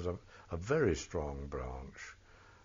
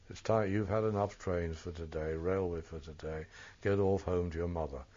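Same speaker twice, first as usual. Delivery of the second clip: ordinary delivery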